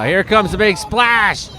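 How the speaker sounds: slow, high, eager announcing of the splash; excited build-up. Real, not acted. Telling viewers about a wave, excited and drawn out. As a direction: drag it out, waiting for the splash.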